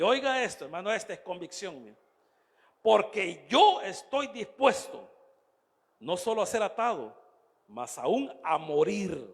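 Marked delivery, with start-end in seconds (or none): 2.8-9.3 slow, loud, resolute proclamation; emphatic declaration. Acted. Preacher declaims Paul's readiness to die, emphatic declaration. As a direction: declaim it forcefully, leaning on key words.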